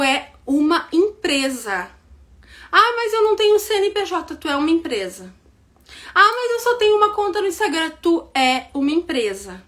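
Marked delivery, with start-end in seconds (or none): 2.7-4.4 mock excuse. Mocking a follower's excuse. 6.1-7.9 mock excuse. Mocking a follower's excuse.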